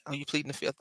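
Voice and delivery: ordinary talk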